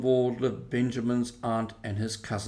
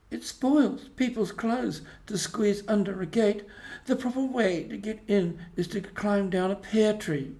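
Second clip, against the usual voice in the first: put-on higher, knowing young rabbit voice; a little rabbit showing off how it's done; little Benjamin Bunny; 8 semitones higher than usual. A narrator's reading. Benjamin telling Peter the proper way in, higher and cocky.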